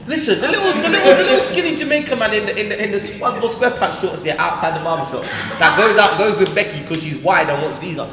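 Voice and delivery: laughing through the words, high; giggling while telling it. Real, not acted.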